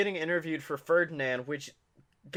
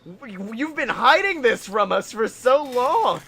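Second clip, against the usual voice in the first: mock disbelief; 4 semitones higher, 4 dB louder than usual. Mock outrage at John's hidden talent.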